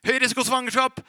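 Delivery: booming doctor announcing the risk; loud doctor's voice. Retold or imitated. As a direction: blare it loud and high like a verdict. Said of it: "først var det en høy stemme, legestemmen".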